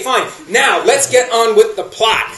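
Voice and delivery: ordinary talk, nothing marked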